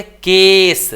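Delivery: केस with a long, loud "ay"; model pronunciation. Shown as a demonstration. Teacher answers how it is read, model pronunciation. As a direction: hold the vowel of केस, then a clear s. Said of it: "तो इसको क्या पढ़ेंगे".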